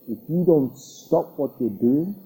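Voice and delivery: ordinary delivery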